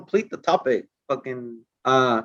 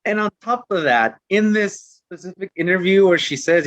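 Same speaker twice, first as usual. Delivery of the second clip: ordinary talk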